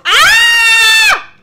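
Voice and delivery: sudden high shriek, cut off mid-word; startled scream. Real, not acted. Streamer hit by a boss mid-taunt, screams. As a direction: yelp as the hit lands.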